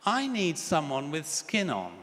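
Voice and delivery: put-on slow, high tiny child's voice; a small child plaintively asking, drawn out and high; small little voice. Little girl answers mummy from upstairs in a small, slow, high voice. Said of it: "a little voice comes down the stairs".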